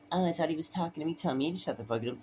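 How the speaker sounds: high, sneering imitation of the officers; mock their high whiny complaint. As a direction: ham up their offended whine. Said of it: "they're like".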